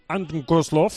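Anton Kozlov in a Russian accent; light Russian accent on the name. Shown as a demonstration. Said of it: "lo puedo decir con acento ruso".

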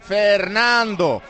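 commentary building to a loud, drawn-out call; live play-by-play. Real, not acted. Commentator calls a shot flying over, rising live play-by-play. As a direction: start measured, then climb with the attack and stretch words.

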